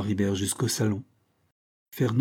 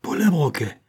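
louder, higher cry of recognition; startled exclamation; 5 semitones higher, 4 dB louder than usual. A narrator's reading. Villain recognising the detective's voice, startled exclamation. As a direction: exclaim the enemy's name. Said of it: "s'écria-t-il".

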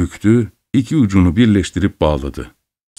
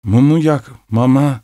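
ordinary delivery

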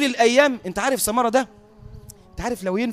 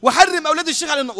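high, raised call of a supplication; dictated prayer; 7 semitones higher, 4 dB louder, faster than usual. Retold or imitated. Sheikh dictating a prayer to Samara, voice raised high. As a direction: lead the prayer line with voice lifted. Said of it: "الشيخ بيمليه بقى".

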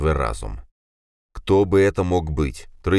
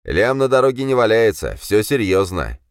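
cold; 6 semitones higher, 5 dB louder than usual. A narrator's reading. Betrayer shows greed.

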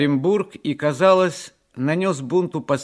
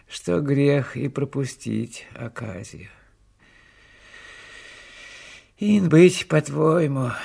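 put-on devoted old servant. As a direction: give in fondly as a touched old servant. Old servant Savelich gives consent.

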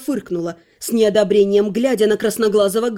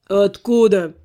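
skeptical; slower than usual. Acted. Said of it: "скептически уточнил жрец".

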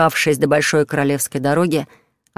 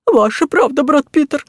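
much higher, louder frightened squeak; 10 semitones higher, 5 dB louder than usual. A narrator's reading. Terrified junior monk backing brother Peter, squeaking. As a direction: pipe it out scared stiff. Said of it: "пропищал тот".

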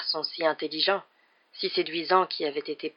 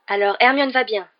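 higher, louder relieved outburst; exclamation; 5 semitones higher, 8 dB louder than usual. A narrator's reading. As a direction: burst out at the good news. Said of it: "s'exclama Ron". (exclamation vive, plus aiguë et forte)

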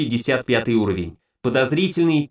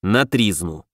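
low, grave, heavy delivery; weighty pronouncement; 4 semitones lower, slower than usual. A narrator's reading. Hero tells gnoms it's for a wake, low grave pronouncement. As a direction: drop it like a funeral verdict. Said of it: "внушительно бросил".

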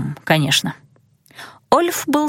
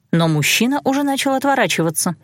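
ordinary delivery

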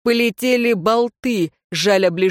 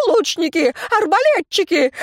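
raging shout; 9 semitones higher than usual. A narrator's reading. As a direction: scream for the archers. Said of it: "бесновался Элихард". (бешеный крик)

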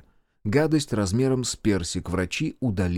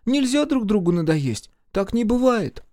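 put-on high-pitched boy, insisting; sulky child; 10 semitones higher than usual. A narrator's reading. Микки argues with his sister, sulky. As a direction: protest like a pouting little boy. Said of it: "Микки насупился".

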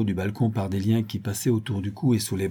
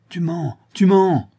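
high, loud, slow grating accusation; grating retort; 8 semitones higher, 6 dB louder than usual. A narrator's reading. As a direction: grind out the accusation. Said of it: "grinça-t-il". (grincer avec colère)

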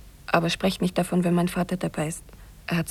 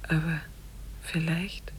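sad whisper; 2 semitones lower, 11 dB quieter than usual. Acted. Gertrud grieves for her mother.

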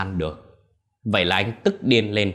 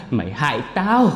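cursing; 6 semitones higher, 5 dB louder than usual. Acted. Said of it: "mà chửi".

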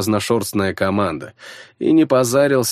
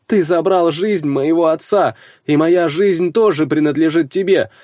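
higher, earnest warrior's plea; solemn plea; 8 semitones higher, 4 dB louder than usual. A narrator's reading. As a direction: beg for your father's burial. Sigmund humbly pleads.

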